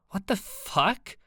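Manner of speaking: slow, high snap of disbelief; snapping. Acted. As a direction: bite out each word in shock. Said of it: "Ava snapped".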